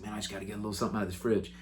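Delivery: soft, fast sneaky murmur; hungry mutter. Retold or imitated. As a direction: sneak a snack at night. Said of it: "He's like".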